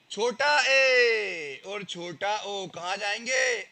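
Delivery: slow and drawn out, each vowel name stretched; teacher stretching a quiz question. Shown as a demonstration. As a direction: ask it slowly so the class follows. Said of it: "teacher quizzing class".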